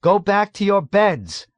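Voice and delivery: high, loud urgent plea; pleading. A narrator's reading. Nurse trying to clear patients away, pleading loudly. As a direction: beg them to obey. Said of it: "Nurse Duckett pleaded".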